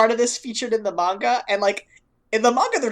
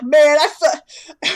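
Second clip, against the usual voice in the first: put-on higher, louder, slow tough-guy Brooklyn accent; Joey's Brooklyn accent; 6 semitones higher, 7 dB louder, much slower than usual. Trying Joey's voice, a slow mock Brooklyn accent. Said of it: "what does he sound like?".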